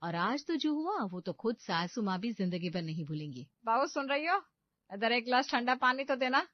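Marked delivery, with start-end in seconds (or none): 3.7-6.5 loud, higher call across the house. Acted. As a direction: summon the bride bossily. Mother-in-law calls bride from afar.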